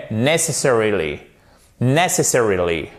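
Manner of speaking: "necessarily" with NESS stressed, falling through the rest; stress on first syllable. Shown as a demonstration. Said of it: "la première ici pour ce mot qui sera accentué". (« necessarily » avec accent tonique sur NESS)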